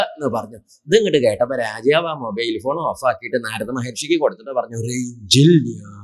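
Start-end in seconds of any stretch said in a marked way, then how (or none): 4.8-6.0 very slow, stretched punchline; mock-solemn, lingering delivery; comic drawn-out announcement. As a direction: draw the word right out like a fading call. King's comic hang-up in retold story.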